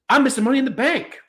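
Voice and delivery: high, cocky Australian voice; Waller Australian impression. Mocking Waller in a high, cocky Australian accent. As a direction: toss it off like a smug Australian heel. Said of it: "That was a good accent".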